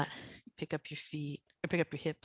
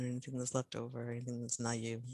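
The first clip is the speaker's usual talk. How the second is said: ordinary talk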